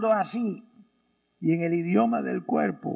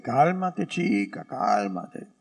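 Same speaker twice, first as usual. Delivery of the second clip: put-on low, lightly Cuban "calm down, girl"; low mock-Cuban soothing; slight Cuban accent; 7 semitones lower, slower than usual. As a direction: play it mildly Cuban, dropping low. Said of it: "Tenía un acento un poco cubano".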